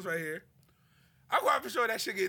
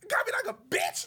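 usual speaking voice

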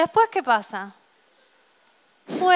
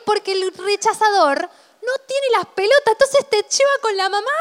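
indignant high rant; 9 semitones higher than usual. Real, not acted. Indignada explica al rechazador.

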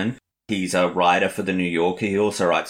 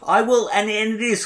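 high put-on voice; 13 semitones higher, 3 dB louder than usual. Still in the high voice.

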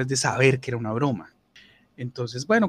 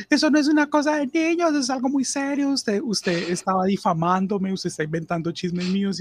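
much higher, outraged coworker protest; indignant whiny protest; 8 semitones higher than usual. Retold or imitated. Offended coworker accusing the prankster of defamation, high and indignant. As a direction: squeal out your wounded pride.

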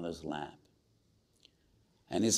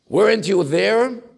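loud, higher accusing challenge; heavenly rebuke; 12 semitones higher, 13 dB louder than usual. Acted. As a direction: thunder the question down at them. Preacher rebukes students from heaven.

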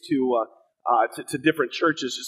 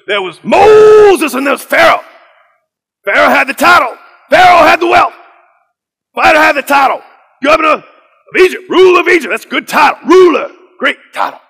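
Pastor Kevin Cosby; 13 semitones higher, 13 dB louder than usual. Retold or imitated. Pastor sweeping through the Bible for students, shouted preacher imitation.

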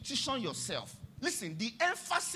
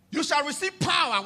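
proclaiming scripture; 6 semitones higher, 7 dB louder than usual. Acted. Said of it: "When Jesus said".

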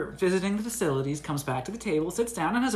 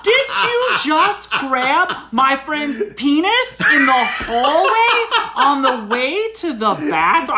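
high, slow, booming mock accusation; getting louder; 7 semitones higher, 6 dB louder, slower than usual. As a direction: repeat the accusation louder, word by word. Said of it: "I'm getting a little louder here".

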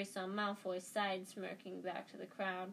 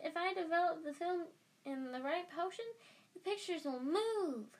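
high-pitched, eager schoolboy; high, thrilled little-boy voice; excited boy Colin; 9 semitones higher than usual. Acted. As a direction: gush like a starstruck kid. Boy's excited lines in narration.

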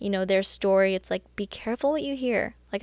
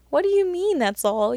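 mock disbelief; 7 semitones higher, 4 dB louder than usual. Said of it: "and it's like".

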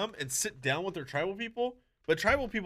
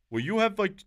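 put-on Indian accent; 5 semitones higher, 6 dB louder than usual. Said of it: "thick Indian accent".

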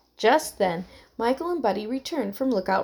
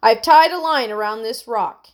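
raised voice calling across water; mild yell; 8 dB louder than usual. A narrator's reading. Terrence to the girls from the shore, mild yell. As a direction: call it out, half yelling. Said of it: "Terrence yelled from the shore".

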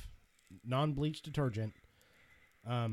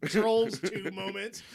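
usual speaking voice

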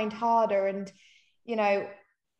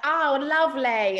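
mock British accent, drawn out and louder; hammed-up, slow British mimicry; butchered British accent; 4 semitones higher, 5 dB louder than usual. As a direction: badly imitate a British accent, stretching the vowels. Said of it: "butchered, like, a British accent".

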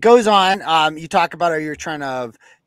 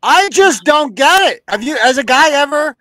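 baffled; 8 semitones higher, 7 dB louder than usual. Real, not acted. Baffled by the text.

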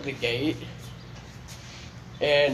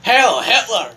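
loud mock salute shout; 6 semitones higher, 9 dB louder, slower than usual. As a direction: bark it out loudly. Shouts it again loudly.

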